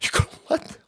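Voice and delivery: high, laughing disbelief; mock foreign leaders laughing. Foreign leaders marvel at US voting, laughing high. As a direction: cackle in shocked disbelief at America. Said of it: "They go".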